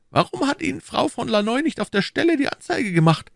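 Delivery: high-pitched, insistent questioner; the Cardinal. A narrator's reading. (hoher, fragender Kardinal)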